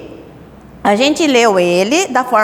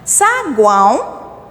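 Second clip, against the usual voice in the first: slow demo; much slower than usual. Shown as a demonstration. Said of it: "teacher demo of example word".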